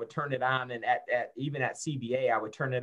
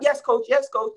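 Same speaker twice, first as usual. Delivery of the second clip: high, meek player's answer; mock yes-sir reply; imitating own young self; 12 semitones higher, 6 dB louder than usual. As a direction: answer the coach like a scared player. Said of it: "I was like".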